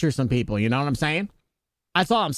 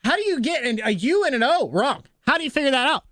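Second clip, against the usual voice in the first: incredulous; 10 semitones higher than usual. Real, not acted. Baffled at the mispronunciation.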